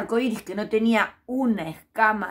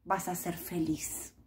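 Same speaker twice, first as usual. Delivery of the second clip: soft, slow whispered wisdom; 3 semitones lower, 8 dB quieter than usual. Acted. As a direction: breathe the secret out gently. Octopus's wise final advice.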